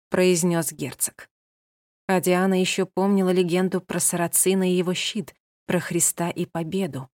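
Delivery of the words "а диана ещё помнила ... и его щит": usual speaking voice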